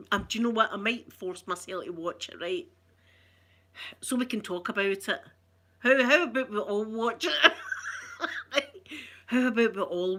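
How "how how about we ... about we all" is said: breaking into a laugh while talking; real giggle through the words. Real, not acted. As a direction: suggest it, tickled by the idea. Laughing as she suggests watching.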